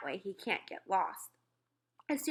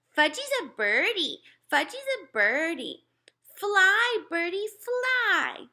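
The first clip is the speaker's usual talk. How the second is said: put-on excited toddler; 11 semitones higher, 6 dB louder, slower than usual. Toddler pretends to fly.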